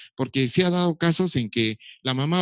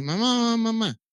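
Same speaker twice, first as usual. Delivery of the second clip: put-on a child calling mamá, pitched higher; calling for mama while pointing; lightly voiced toddler calling mamá; 8 semitones higher than usual. Shown as a demonstration. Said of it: "el niño señala lo que quiere y dice".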